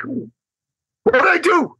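very high, drawn-out mock wail; slow, squeaky comic panic; freaking out. Man helpless when a woman cries, mock freaking out. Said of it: "I'm freaking out".

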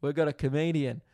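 high, loud shooing voice; hammed-up boss shooing the doctor; imitating neighbour. Neighbour loudly shooing the doctor away. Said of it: "he was just like".

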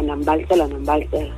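normal talking voice

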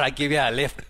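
put-on Scottish accent that drifts into Irish; botched Scottish accent. Driver offering a lad a lift, botched Scottish accent. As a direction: attempt Scottish, land nearer Irish. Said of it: "That's, that's Irish".

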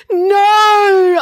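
high, loud wailing no; crying out. Acted. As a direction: cry it out in protest. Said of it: "I cried".

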